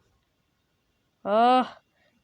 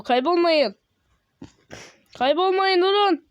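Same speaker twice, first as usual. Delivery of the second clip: raised, higher call to teammates; urgent call to wait; 6 semitones higher, 3 dB louder, slower than usual. Real, not acted. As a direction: call after people leaving you behind. Calling to players moving away.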